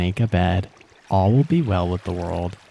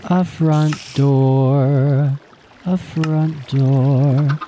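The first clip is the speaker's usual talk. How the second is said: drawn-out announcement; 4 semitones higher, 4 dB louder, much slower than usual. Pretend. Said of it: "What can we make?".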